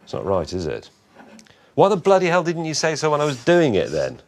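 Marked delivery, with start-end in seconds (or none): 1.8-4.2 exasperated angry outburst; loud, fed-up swearing rant; swearing tirade. Real, not acted. Angry outburst over wasted thread.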